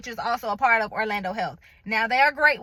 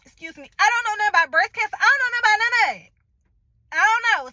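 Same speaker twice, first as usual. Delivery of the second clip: higher, louder, fast frustrated outburst; higher, louder and fast, venting frustration at the phone call; frustrated rant; 8 semitones higher, 4 dB louder, faster than usual. Retold or imitated. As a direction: rattle it off, fed up and exasperated. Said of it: "'Cause now I'm getting frustrated".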